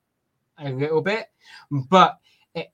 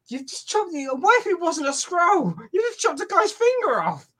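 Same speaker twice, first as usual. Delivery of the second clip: very high-pitched incredulous outburst; mock disbelief; 14 semitones higher than usual. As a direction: squeal it in hammed-up shock. Acting disbelief at the severed finger.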